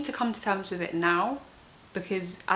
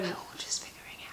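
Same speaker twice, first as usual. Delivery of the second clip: hushed confiding whisper; 10 dB quieter, faster than usual. Real, not acted. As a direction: whisper it like a secret.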